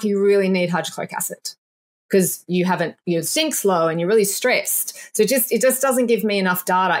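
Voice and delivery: ordinary talk, nothing marked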